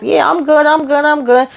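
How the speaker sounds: high, beaming we good; giddy reassurance. Retold or imitated. Gary grinning, assuring Aaron all is good, high. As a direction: brush off worries with a grin.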